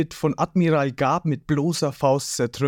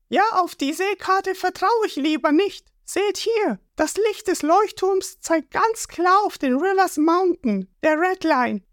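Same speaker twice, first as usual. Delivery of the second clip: Nami explaining. A narrator's reading. Nami explains the sea chart.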